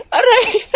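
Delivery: high-pitched cry of "ouch"; pained yelp. Acted. Bully hurt in a scuffle, pained yelp. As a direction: yelp out in sudden pain, very high.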